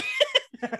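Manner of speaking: very high burst of laughter; high squeal of laughter. Real, not acted. Laughing at Stefan's birthday hint, squealing high.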